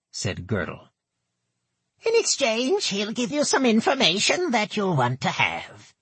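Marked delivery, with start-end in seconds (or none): 2.1-5.9 put-on high, nasal old goblin woman; thick nasal goblin voice. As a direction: pinch it through the nose, goblin. Gertel speaks.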